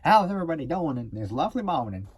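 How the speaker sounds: loud, high, hammed-up Texas greeting; Texas accent. Vlogger greeting everybody in a loud put-on Texas accent. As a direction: greet the crowd in a big Texas twang. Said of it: "my Texas accent".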